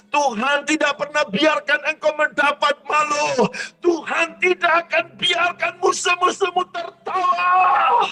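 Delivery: shouted, soaring revival preaching; full-force preaching. Acted. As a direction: preach it like a climax and lift the whole room.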